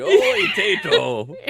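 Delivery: put-on slow, loud old Irish man hail, laughing; jokey old Irish man greeting. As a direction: drawl it like an old Irishman calling a friend. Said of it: "say it like an old Irish man".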